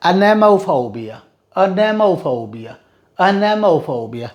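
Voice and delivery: anemophobia said very slowly, a-ne-mo-pho-bia; model pronunciation. Shown as a demonstration. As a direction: sound out anemophobia syllable by syllable. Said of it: "vocabulary drill, repeated slowly".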